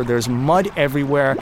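ordinary talk

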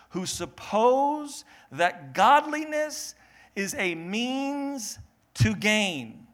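slow, drawn-out solemn reading of the verse; slow scripture reading. Acted. Preacher reading scripture slowly to the congregation. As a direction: read the verse slowly and weightily.

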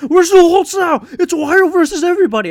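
high, loud, fast hype-man rant; mock wrestling promo. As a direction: ham it up like a wrestler cutting a promo. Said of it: "cutting promo on Lou Holtz".

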